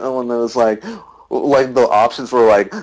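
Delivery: usual speaking voice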